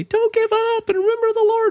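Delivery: high-pitched imitated calling out; megaphone call. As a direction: call encouragement as if through a megaphone. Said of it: "used his megaphone to say".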